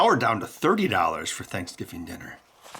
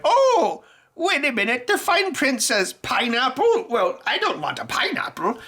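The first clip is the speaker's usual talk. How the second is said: high, quavering mock old-timer; hammed-up elderly shopper voice; old person voice; 11 semitones higher than usual. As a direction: play a confused senior reading fine print. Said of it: "the same old person reading this".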